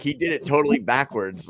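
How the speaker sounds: ordinary delivery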